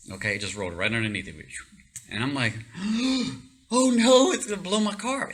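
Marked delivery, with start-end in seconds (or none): 2.6-3.4 high startled gasp; sharp gasp. Retold or imitated. Telling a friend the bomb rolled under the car, sharp gasp. 3.7-5.3 higher, louder panicked "oh no"; panicked cry. Retold or imitated. Seeing the bomb roll under his car, panicked cry.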